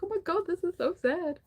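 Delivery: high mock-sad cry of "so sad"; hammed-up sadness. A reader recalls crying over the book: high mock-sad cry. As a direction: overplay being moved to tears. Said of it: "I was like".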